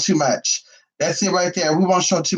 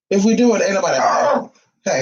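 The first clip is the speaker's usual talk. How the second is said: ordinary delivery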